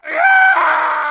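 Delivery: pained shout. Acted. Cry of pain in fight.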